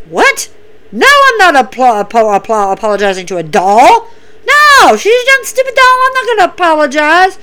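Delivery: high, whiny mock protest; mocking Shayna. Shayna refusing to apologize to a doll, high whiny mock. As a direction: squeal it out like a bratty wrestler refusing. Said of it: "Shayna's like".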